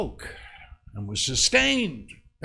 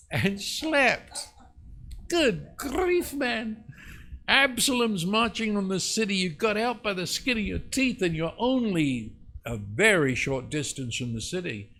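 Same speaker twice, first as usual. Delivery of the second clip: alarmed, high-pitched scolding; incredulous protest; 8 semitones higher than usual. Acted. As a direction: exclaim in disbelief and urge him to flee. Acts out astonished reaction to David.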